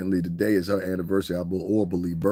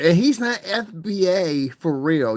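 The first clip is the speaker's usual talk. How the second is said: high, slow mocking voice of a commenter; drawn-out put-on sneer at the critic; mocking quote; 9 semitones higher, 4 dB louder, slower than usual. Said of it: "They also mentioned".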